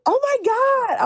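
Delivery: high, loud, excited imitation of a fan; starstruck surprise. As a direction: squeal like a fan meeting her idol. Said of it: "And she's like".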